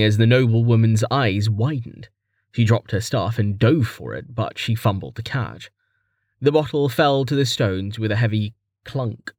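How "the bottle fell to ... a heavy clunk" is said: ordinary delivery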